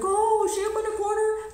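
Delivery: high, slightly put-on voice for the young man; voicing Malik. Malik tells her the store lady has his phone, lightly voiced. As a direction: play the man spinning a story for cash.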